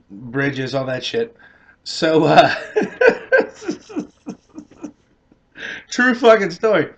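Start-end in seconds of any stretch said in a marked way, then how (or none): 2.6-4.0 high squeaky laugh; high giggle. Real, not acted. Telling the story, he breaks into high giggles.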